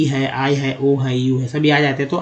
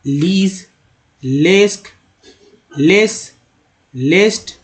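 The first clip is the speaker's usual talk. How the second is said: teacher reading a drill list; word list drill; slower than usual. Acted. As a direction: read each word out slowly for learners to repeat.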